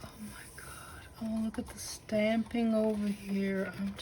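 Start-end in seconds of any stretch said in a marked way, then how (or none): 0.0-1.0 breathless hushed gasp of wonder; awed whisper. Real, not acted. Vlogger awed by a swap journal, soft whisper. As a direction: whisper it in awe at the page.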